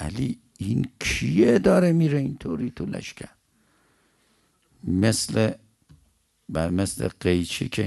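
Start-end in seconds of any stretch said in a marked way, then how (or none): 0.0-3.3 raised, louder question of astonishment; acted amazed question. Malik asks Ali who is cutting through the army, amazed. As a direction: push the pitch and volume up in wonder. Malik marvels at the unknown fighter.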